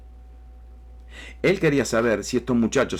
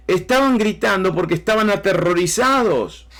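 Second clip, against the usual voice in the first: higher, louder, fervent preaching; preaching at full force; 8 semitones higher, 3 dB louder than usual. Acted. Preacher retelling the storm to his church, at full fervent force. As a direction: drive the storm scene home to the congregation.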